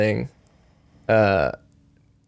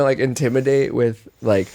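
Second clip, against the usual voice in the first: ordinary talk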